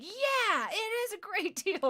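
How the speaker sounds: high, over-keen small-talk voice; eager agreement. Retold or imitated. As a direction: agree brightly to keep the stranger connected. Reenacting eager small-talk reply.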